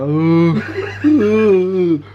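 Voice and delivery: loud, high laughing ooh; dazed ooh. Retold or imitated. Joe answers the boy with a loud, high, laughing ooh. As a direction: groan out a loud giddy ooh. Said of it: "I was like".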